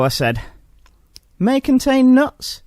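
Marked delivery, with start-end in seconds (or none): 1.4-2.6 slow, higher, polite shop assistant; a hint of a fussy clerk checking a list; slow inquiring assistant. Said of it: "he ventured".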